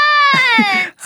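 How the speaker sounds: burst of laughter. Acted. Laughs at the joke.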